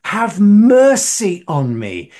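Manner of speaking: loud, high, drawn-out plea; retold shout. Blind man begging Jesus for mercy, retold shout. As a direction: call out slowly for mercy. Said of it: "And he shouts".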